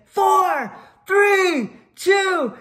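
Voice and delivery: high, shouted clock countdown; announcer counting down. Retold or imitated. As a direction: call out the final seconds. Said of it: "I count out the clock".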